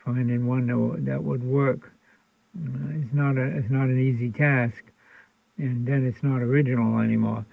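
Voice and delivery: usual speaking voice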